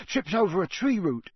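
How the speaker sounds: high, irritated grumble; angry. Acted. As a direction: snap out the excuse. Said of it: "he said angrily".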